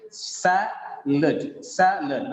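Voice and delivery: salad with sa strong and the second a a weak schwa; stress on sa. Shown as a demonstration. Teacher repeating the right stress for the class. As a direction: lean on sa, swallow the second a. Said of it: "a, shwasa".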